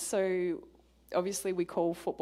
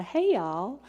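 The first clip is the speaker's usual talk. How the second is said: lightly mock American Southern greeting, higher and louder; American southern accent; 4 semitones higher, 6 dB louder than usual. Australian joking to the audience about southern accents, mock American Southern accent. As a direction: put on a Southern US drawl for a moment. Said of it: "I have a southern accent".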